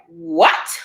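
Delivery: mock-stunned low "what"; retold reaction of shock; shocked disbelief. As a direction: imitate his stunned disbelief. Retold shock at pregnancy news.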